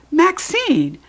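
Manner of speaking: calling up for attention; hollering. A narrator's reading. Judy B hollering up at Maxine. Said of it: "I hollered".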